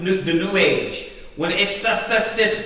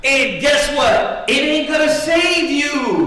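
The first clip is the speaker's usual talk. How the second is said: raised, drawn-out preacher's warning; emphatic preaching; 6 semitones higher, 3 dB louder, slower than usual. Real, not acted. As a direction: drive the warning home to the congregation. Preacher drives home the warning.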